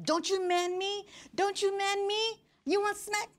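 high, sharp mock-mother warning; scolding mom. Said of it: "my mom was like".

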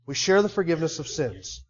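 normal talking voice

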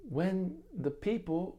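put-on high, slow West Indian accent; sweet West Indian accent. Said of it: "in the most sweet West Indian accent".

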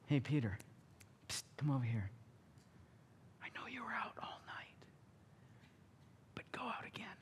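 breathy secret whisper to Peter. Acted. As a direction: lean in and whisper the instruction.

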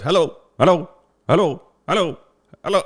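high, loud mock phone caller; persistent caller. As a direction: imitate someone repeatedly calling hello down the line. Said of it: "guy with an Indian accent".

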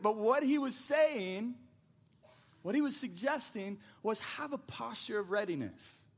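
usual speaking voice

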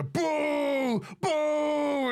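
long, high-pitched boos; booing crowd. Acted. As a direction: draw out each boo high, like a jeering crowd. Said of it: "crowd just start booing".

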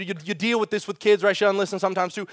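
ordinary talk, nothing marked

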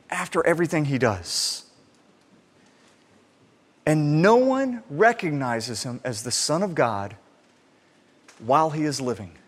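usual speaking voice

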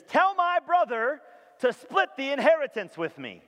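loud and high, pressing his claim; yelling out. Retold or imitated. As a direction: call it out over the crowd. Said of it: "A man yells out to him".

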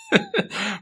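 short high-pitched chuckle; brief amused giggle at own aside. Real, not acted.

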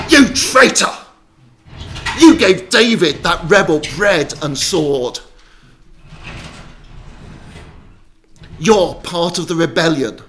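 furious, high, shouted denunciation; ranting accusation. Acted. King starts at the priest before the crowd, ranting accusation. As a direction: bellow the accusations like an enraged king. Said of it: "the king starts at him".

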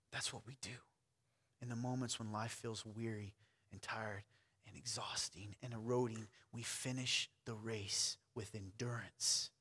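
quiet, slowed-down encouragement; hushed exhortation. Real, not acted. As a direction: ease the voice way down.

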